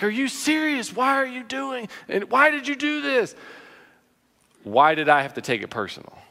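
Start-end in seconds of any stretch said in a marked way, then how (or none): 0.0-3.4 much higher, incredulous parent; imitating his own exasperated outburst at the kid; exasperated scolding. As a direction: reenact losing patience with the child. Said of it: "I'm like".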